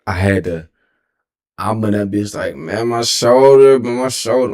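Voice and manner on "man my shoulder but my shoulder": crying. Retold or imitated. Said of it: "I was really crying".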